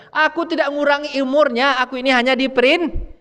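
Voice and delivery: high voice of the angel insisting he only obeys; the angel defending himself to the mourners; angel of death. Retold or imitated. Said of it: "kata malaikat maut". (malaikat maut membela diri, suara tinggi)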